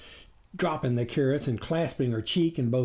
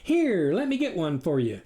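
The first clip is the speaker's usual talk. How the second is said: high folksy farm wife; chirpy country wife offering; farmer's wife voice; 11 semitones higher than usual. A narrator's reading.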